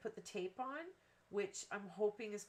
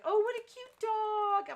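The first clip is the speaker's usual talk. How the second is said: cooing neighbour; 13 semitones higher, 11 dB louder than usual. Retold or imitated. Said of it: "they're like".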